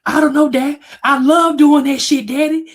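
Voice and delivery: put-on high, loud little-boy voice; a frightened six-year-old blurting it out; scared little kid. Scared boy answering his dad, high little-kid voice. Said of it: "And I said".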